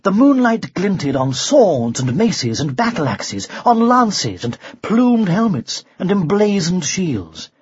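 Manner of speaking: plain reading voice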